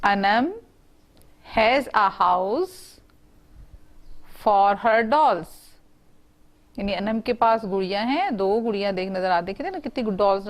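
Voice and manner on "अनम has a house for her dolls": very slow, word-by-word reading for students to follow; slow read-along. Shown as a demonstration. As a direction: read it slowly so the class can repeat. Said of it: "आप इसे मेरे साथ साथ पढ़ें".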